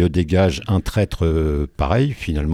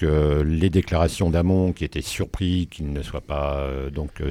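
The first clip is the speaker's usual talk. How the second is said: ordinary talk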